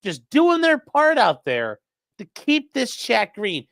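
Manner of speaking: loud, slow, drawn-out announcer hype; mock announcer. Streamer hailing chat's gifted subs, mock announcer. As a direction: proclaim it like a big public announcement.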